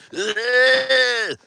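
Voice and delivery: long, high, strained croon; strained Trump groan. Retold or imitated. Streamer mimicking Trump digging for his voice, strained groan. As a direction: dig for the voice in one drawn-out noise. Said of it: "really digging for his voice".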